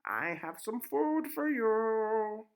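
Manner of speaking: very high, loud, slow witch's call; a witch singing up the tower, high and drawn out; high, slow, loud crone voice. Acted. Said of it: "As the witch climbed up".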